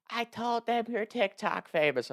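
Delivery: high, drawn-out mock mom voice; slow singsong falsetto for mom; imitating mom. Mom tells son about the host, high-pitched imitation. Retelling mom's proud announcement.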